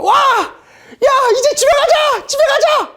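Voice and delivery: high-pitched jubilant shout; acted burst of celebration; overjoyed cheering. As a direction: cheer wildly like soldiers told they can go home. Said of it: "이들이 기뻐요".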